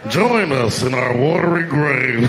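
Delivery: slow, eerie ghost voice; ghostly drowned dead. Acted. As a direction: moan it from the ocean floor. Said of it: "this is my impression".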